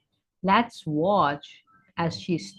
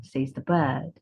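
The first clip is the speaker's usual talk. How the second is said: plain reading voice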